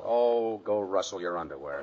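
low, sinking dismissive grumble; grumpy brush-off. Acted. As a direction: brush him off sourly, voice dropping. Snubbing the man he scorns.

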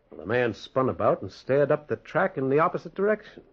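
ordinary delivery

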